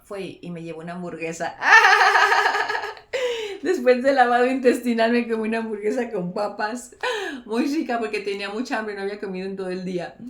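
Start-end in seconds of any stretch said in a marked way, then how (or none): 1.6-3.6 loud laugh. Real, not acted. Laughs at hamburger after lavage. 3.6-10.2 louder, through real laughter; can't stop laughing as she tells it; laughing while talking. Real, not acted. Telling viewers about the burger after the lavage, laughing.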